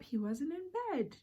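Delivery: normal talking voice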